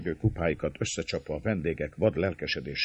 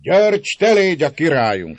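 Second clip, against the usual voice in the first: put-on loud, high drunken toast; drunken old man's shout; old Székely toasting; 14 dB louder than usual. A narrator's reading. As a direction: raise the cup and cry it out. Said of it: "szólt egy vén székely".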